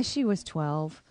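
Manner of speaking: ordinary talk, nothing marked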